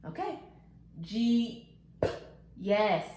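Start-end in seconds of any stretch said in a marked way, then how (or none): 1.0-1.7 JEEP with the final P popped separately; teacher isolating the P at the end of Jeep; ending sound shown. Shown as a demonstration. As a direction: draw out Jeep and set off its last P. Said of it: "let's review these".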